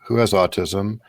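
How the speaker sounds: ordinary talk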